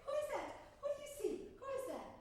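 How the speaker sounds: very high, excited coaxing; high-pitched cooing call. Acted. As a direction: coo to a small child to come over. Calling child Anna over, cooing.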